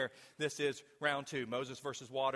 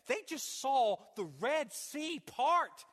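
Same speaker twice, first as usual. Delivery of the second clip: ordinary delivery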